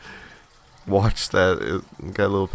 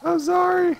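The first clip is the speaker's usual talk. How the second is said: high mock apology; 3 dB louder than usual. Apologizing to lost Yoshi.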